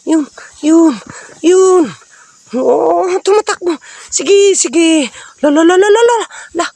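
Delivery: high loud rapid urging; excited calling. Real, not acted. Chasing the creature with viewers, high excited shouting. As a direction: call out wildly as it flees.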